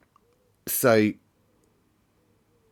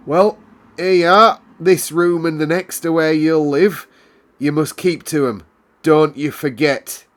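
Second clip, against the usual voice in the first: much higher and louder, brusque housekeeper; unceremonious; 8 semitones higher, 10 dB louder than usual. A narrator's reading. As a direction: bark it out like a curt housekeeper. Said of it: "Missus Medlock said unceremoniously".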